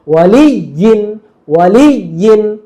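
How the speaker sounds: ওয়ালিয়িন slowly, leaning hard on লিয়ি; ওয়ালিয়িন with a weighted, drawn middle; playful heavy stress. Shown as a demonstration. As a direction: bounce onto the doubled y in ওয়ালিয়িন and hold it. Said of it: "মজা করে বলবে".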